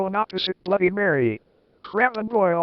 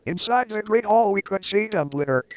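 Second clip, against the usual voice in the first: usual speaking voice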